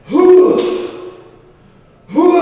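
high, loud breathless whew after the effort; exertion whew. Acted. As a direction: blow out like you just lifted heavy. After working out, power zone.

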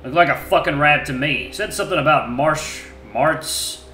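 loud, rough grumbling answers; a surly wolf under questioning; gruff wolf voice. Acted. Wolf recounting.

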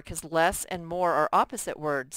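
usual speaking voice

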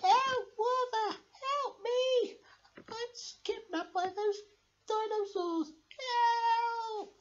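high cries for help. Acted. Kidnapped Bertha calling Werther, squeaky slow cries. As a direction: call out like a tiny captive.

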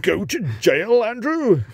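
high, loud gleeful call; gleeful shouted order. Pretend. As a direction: yell it at Andrew with glee. Acting royal Monopoly game.

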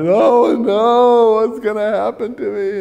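high, loud tipsy wail; drunk emotional. Retold or imitated. Drunk student dreading what happens to him, wailing emotionally. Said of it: "he got drunk emotional".

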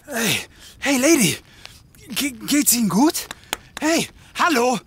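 loud, worried calls to wake her; urgent calling. Acted. Detective calling to the unconscious woman, alarmed. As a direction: shout to rouse her, alarmed.

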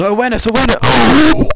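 high, loud cry of protest; loud outburst. Real, not acted. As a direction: shout it out, pitch shooting up to a loud "oh". Sudden outburst during class bans.

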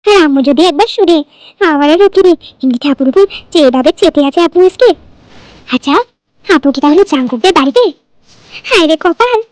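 usual speaking voice

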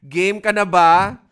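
loud, slow, drawn-out rallying call; game-show host pumping up the room; hyped call-out. Real, not acted. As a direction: wake the whole class up with it. Teacher hyping up sleepy class.

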